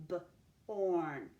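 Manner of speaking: "B-orn" split into a short buh, then a long held orn; sounded out. Shown as a demonstration. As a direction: sound out "B-orn" with a gap after the b. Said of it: "beginning of that syllable".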